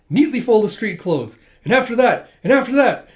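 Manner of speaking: loud, high string of commands; piling on orders. Retold or imitated. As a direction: keep the chores coming, loudly.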